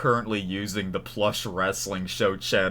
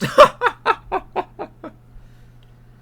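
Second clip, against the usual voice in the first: loud, high burst of laughter; laughing; 12 dB louder than usual. Real, not acted. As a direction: laugh out loud, tailing away. Laughs at lowering his voice.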